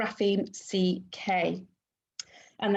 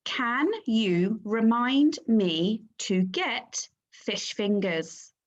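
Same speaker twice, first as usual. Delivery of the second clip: slow dictation; slower than usual. Shown as a demonstration. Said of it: "The sentence that we are going to write today is".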